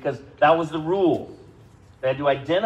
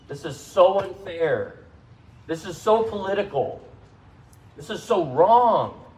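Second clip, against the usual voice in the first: mock complaining; 5 semitones higher, 3 dB louder than usual.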